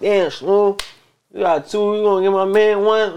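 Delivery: high, teasing mock voice; mocking greedy friend. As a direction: tease like a greedy buddy. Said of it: "Don't be like".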